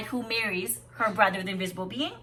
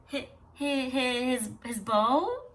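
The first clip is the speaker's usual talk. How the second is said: stammering in surprise; 3 semitones higher, slower than usual. Acted. Sisters caught out by the sister's question, flustered stammer. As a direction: caught out, stuttering the first word. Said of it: "they stammered in surprise".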